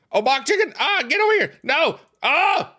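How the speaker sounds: high frantic gamer yell; shrill-pitched frustrated outburst. Real, not acted. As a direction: scream at the game in bursts. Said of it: "Sorry, I'm yelling".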